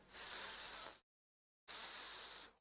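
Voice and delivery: long drawn-out hissing स-; exaggerated snake-like स-; hissed S. Shown as a demonstration. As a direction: hold स- as a sustained hiss. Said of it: "जब आप एस बोलते हो".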